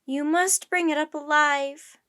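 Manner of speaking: loud, slow, insistent plea; begging. A narrator's reading. Professor pleads with the captain for the creature, begging. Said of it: "begged the professor".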